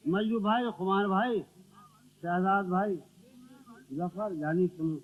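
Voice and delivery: ordinary talk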